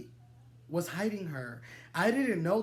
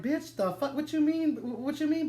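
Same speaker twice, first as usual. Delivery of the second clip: high, incredulous "What you mean?"; disbelieving, pitch way up; shocked outrage; 8 semitones higher than usual. Retold or imitated. Hookup hearing the boyfriend say babe, high outrage. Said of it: "I'm like".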